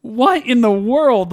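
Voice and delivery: loud, high, drawn-out disbelief; baffled outburst. Retold or imitated. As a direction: stretch it out in exasperated bafflement. Baffled reaction to the scene.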